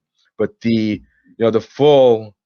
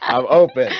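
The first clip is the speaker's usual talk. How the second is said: laughing, a bit higher and louder; 5 semitones higher, 4 dB louder than usual. Real, not acted. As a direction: laugh it out as a teasing repeat. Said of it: "I'm not saying I'm gonna go with what you say".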